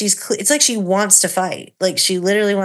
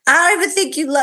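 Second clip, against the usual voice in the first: whiny accusation; 8 semitones higher, 4 dB louder, much faster than usual. Retold or imitated. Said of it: "she's like".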